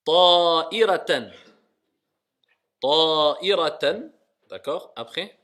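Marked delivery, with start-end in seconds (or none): 0.0-4.1 slow model reading. Shown as a demonstration. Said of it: "teacher models slowly and loudly".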